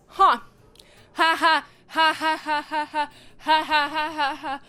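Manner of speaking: loud, hollow mock cackle; ha-ha it out like someone cracking inside; imitated broken laugh. Vlogger imitating Loki laughing as he watches himself die, loud broken laugh. Loki breaks after seeing his death.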